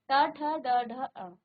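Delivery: ट, ठ, ड, ढ, अण said clearly with tongue curled back; teacher modelling the retroflex row; retroflex series demo. Shown as a demonstration. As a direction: sound out ट ठ ड ढ अण slowly for students. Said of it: "मुख का मूर्धा स्थान".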